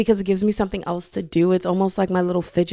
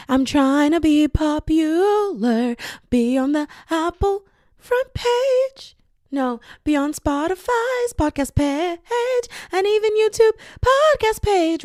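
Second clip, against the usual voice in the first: dreamy; 9 semitones higher than usual. Pretend. Dreamy wish to be popular.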